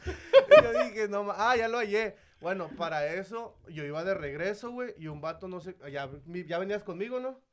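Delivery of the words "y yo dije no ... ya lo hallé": high and loud, words squeezed out through laughter; laughing hard. Retold or imitated. Retelling the find to friends, breaking up laughing. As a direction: barely get it out for laughing.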